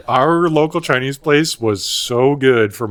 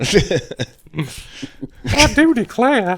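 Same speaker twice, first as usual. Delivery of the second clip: put-on Southern belle accent; 10 semitones higher, slower than usual.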